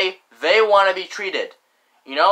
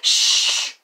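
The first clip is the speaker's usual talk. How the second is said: a quick whispered shush. Retold or imitated. Annoyed people shushing the narrator, whispered shh. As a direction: imitate someone shushing you. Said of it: "they were like".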